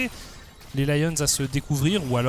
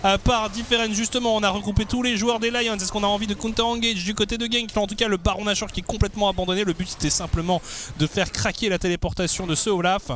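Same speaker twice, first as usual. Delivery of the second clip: esports caster; 9 semitones higher than usual. Acted. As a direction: build the hype through the fight. Live play-by-play of teamfight.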